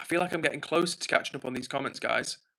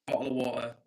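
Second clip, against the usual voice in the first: Bottle of water in a lightly British accent, with a glottal t; 2 semitones lower than usual. Shown as a demonstration. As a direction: give them the British Bottle of water they asked for. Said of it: "But I'll give you what you want".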